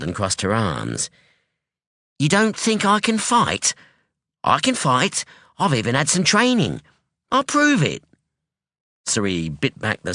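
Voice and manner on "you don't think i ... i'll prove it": lightly voiced sharp young woman, pitched up; a sharp, wary daughter; hint of a guarded young woman; young woman Anyi.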